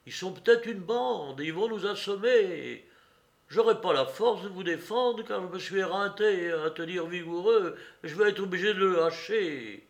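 alarmed helper. A narrator's reading. Courrapier warns mademoiselle of a gang, alarmed. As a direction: plead urgently that danger is near.

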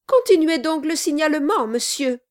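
fast, high, impatient urging. A narrator's reading. Mother-in-law urges the lawyer on, impatient. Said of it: "avec impatience".

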